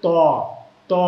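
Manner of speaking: ত as full, rounded "too"; emphatic Arabic "to" on ত; heavy to. Shown as a demonstration. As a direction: round and thicken each ত. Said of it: "আমরা এখানে বলবো".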